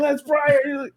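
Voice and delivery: freaking out. Retold or imitated. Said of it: "he was freaking out".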